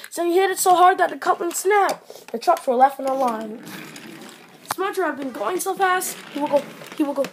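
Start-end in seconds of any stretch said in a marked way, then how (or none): none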